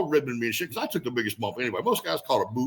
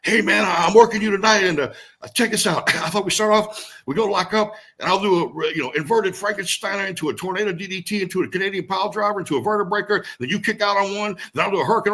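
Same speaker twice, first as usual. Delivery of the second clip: put-on higher, louder, eager kid rattling off moves; a veteran mocking a rookie's eager pitch, higher and louder; mocking a cocky rookie; 8 semitones higher, 4 dB louder than usual. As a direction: play the overexcited young wrestler pitching spots. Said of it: "I got guys that walk up to me".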